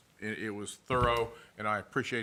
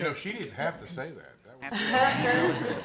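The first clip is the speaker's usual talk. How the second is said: bursting into laughter; laughing; 10 semitones higher, 7 dB louder than usual. Real, not acted. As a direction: laugh through the words. Teasing laugh over compliment.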